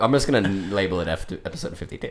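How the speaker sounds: ordinary talk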